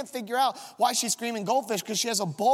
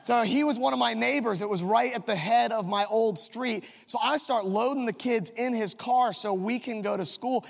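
ordinary delivery

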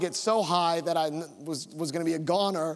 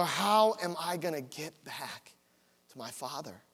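quiet dread; 4 semitones lower, 5 dB quieter than usual. Retold or imitated. Lost boy wondering how to get back, subdued dread. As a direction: let the worry sink your voice lower and softer. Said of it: "this sense of dread came over me".

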